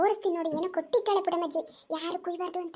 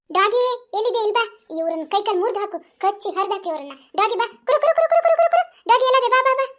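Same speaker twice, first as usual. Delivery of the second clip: high loud call for the dog; 5 semitones higher, 9 dB louder than usual. Acted. Old granny calling her dog to attack thieves, high loud shout. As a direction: shout for the dog to come bite.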